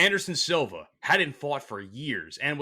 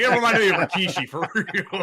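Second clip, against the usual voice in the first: laughing through the words; laughing as he recalls the fight; laughing quote; 8 semitones higher, 7 dB louder than usual. Retold or imitated. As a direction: giggle it out mid-sentence. Laughing while quoting himself.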